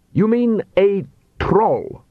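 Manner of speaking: slow, haughty voice from the coach; an impatient noble demanding answers; aristocratic vampire. A narrator's reading. Master from coach.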